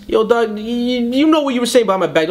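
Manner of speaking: high, heated callout; confronting. Retold or imitated. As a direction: call him out to his face, heated. Confronting Caliente at event.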